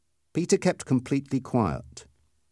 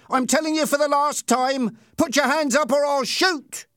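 very high-pitched final warning; high, insistent bullying shout; shouted threat; 14 semitones higher than usual. A narrator's reading. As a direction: threaten him at the end of your patience. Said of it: "shouted Ernie".

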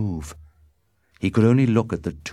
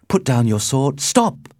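loud, high, jumping higher at the end; urgent plea; 7 semitones higher, 6 dB louder than usual. A narrator's reading. Romeo tries to stop Mercutio, calling out urgently. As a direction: call out to halt the fight.

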